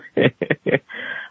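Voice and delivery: soft breathy chuckle; quiet airy laugh at his son; father laughing at his son over the phone, soft breathy chuckle. Retold or imitated. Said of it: "My father starts laughing".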